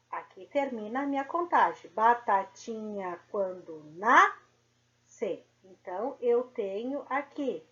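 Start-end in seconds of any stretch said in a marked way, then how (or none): 1.9-5.4 slow, syllables drawn out; slow syllable count. Shown as a demonstration. Teacher recounting syllables of the rhyme, slow. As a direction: count each syllable again. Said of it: "Então, vamos lá".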